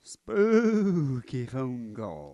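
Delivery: very slow, drawn-out spooky horror host; Vincent Price voice. Pretend. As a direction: drag it out like an old horror film host. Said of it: "You gotta do your Vus-, Vincent Price".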